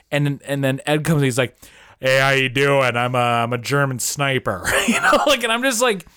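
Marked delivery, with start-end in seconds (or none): 2.0-4.6 exaggerated casual American accent; mock American movie villain with a broad American accent; Ed Harris. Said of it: "who had an American accent".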